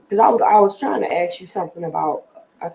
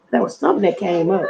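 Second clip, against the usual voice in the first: ordinary talk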